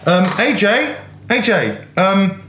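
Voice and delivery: high, bouncy host voice; game show host. Acted. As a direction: hype up the contestant.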